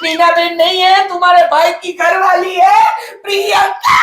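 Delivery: hammed-up distraught outburst. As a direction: wail the correction, then cry out for help. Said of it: "दीदी, दीदी, दीदी, calm down".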